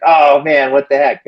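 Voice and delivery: hammed-up childish protest; frustrated kid. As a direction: imitate a beaten kid's outcry. Girl loses race again.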